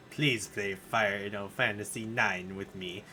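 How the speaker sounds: low mock AI plea; mock computer voice. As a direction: imitate the computer begging. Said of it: "Normally you're like".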